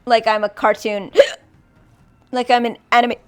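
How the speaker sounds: gasping hiccups between words. Real, not acted. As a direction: talk through cartoon hiccups. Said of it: "I'm hiccuping adorably".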